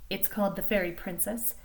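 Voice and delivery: ordinary delivery, nothing marked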